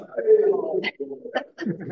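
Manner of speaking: a squealing high laugh falling away. Real, not acted. Showing the fly selfie to listeners, high falling laugh. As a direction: let a high laugh tumble down.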